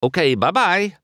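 high-pitched brush-off farewell; hasty goodbye. A narrator's reading. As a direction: get away with a quick goodbye. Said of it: "said quickly".

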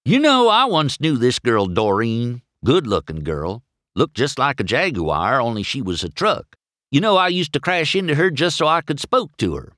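eager, rambling storytelling voice; chattering Mater. A narrator's reading. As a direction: rattle on like a chatty country friend. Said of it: "began chattering away".

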